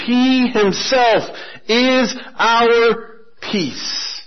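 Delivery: very slow, each word weighted; solemn slow refrain. Acted. Preacher repeating the key verse, very slow and solemn. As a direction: let the verse land slowly.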